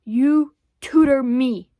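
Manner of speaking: slow, loud jungle-man delivery; heavy, separated words like a primitive man; ape-man. Acted. Boy demanding tutoring from diarist, ape-man style. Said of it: "It was very Edgar Rice Burroughs".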